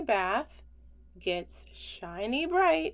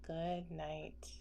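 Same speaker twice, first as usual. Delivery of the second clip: a much softer, fading good night; soft goodnight; 4 semitones lower, 10 dB quieter than usual. Acted. Reader saying goodnight to bulldozer, fading softly. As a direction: barely breathe good night. Repeated goodnight in bedtime book.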